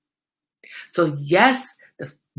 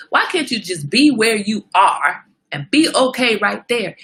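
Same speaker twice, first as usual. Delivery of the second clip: ordinary talk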